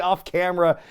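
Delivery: ordinary talk, nothing marked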